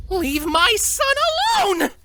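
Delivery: high, slow furious demand from a mother. Acted. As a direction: protect your child with fury. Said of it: "she demanded furiously".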